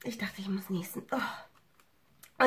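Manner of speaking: hushed whisper after a near sneeze. Real, not acted. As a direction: whisper it to yourself. Aside after almost sneezing.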